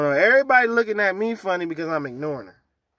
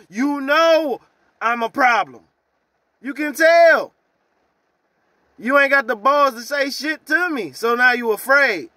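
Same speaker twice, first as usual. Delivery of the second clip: angry rant; 7 semitones higher, 5 dB louder than usual. Real, not acted. Ranting at coworker.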